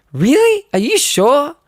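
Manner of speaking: high incredulous squeak; can't believe anyone would turn this down; surprised disbelief. Retold or imitated. The security guard asking the shy teen, high disbelief.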